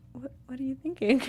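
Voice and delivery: breathy disbelieving whisper; hushed mock disbelief; incredulous whisper. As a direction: whisper it like you can't believe what you're seeing. Mocking baffled reaction to hairstyle.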